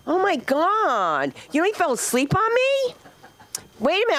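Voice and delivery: high, stretched-out disbelief; mock outrage. Real, not acted. Comic calls out someone who yawned on her, mock-outraged. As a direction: protest with long, high-pitched incredulity.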